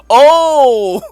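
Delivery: loud, high, drawn-out oh; big re-enacted aha moment; sudden realization. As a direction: exclaim it as the penny drops.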